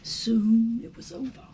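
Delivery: ordinary delivery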